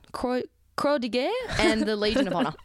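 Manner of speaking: "Croix de Guerre" in a put-on French accent, stumbling on "Croix"; attempted French. Shown as a demonstration. Reading out French military honours, a hammed-up French accent. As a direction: fumble through "Croix, Croix de Guerre" with a mock French sound. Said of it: "Stick to what you know".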